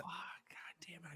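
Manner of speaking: breathy, defeated groan of a curse; ruined by a word, whispered in defeat; mock despair whisper. Real, not acted. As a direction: whisper it like a lost cause.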